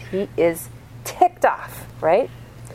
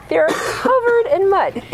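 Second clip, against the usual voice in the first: exasperated; 13 semitones higher, 5 dB louder, slower than usual. Retold or imitated. Mother arguing with muddy child.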